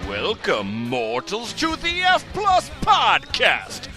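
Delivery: booming spooky horror host welcome. Acted. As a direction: greet the mortals like a crypt keeper. Podcast intro in spooky voice.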